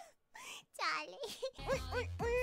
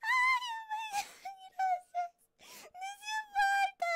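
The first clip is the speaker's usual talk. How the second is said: tearful; 11 semitones higher, 3 dB louder than usual. Real, not acted. Overwhelmed by a donation.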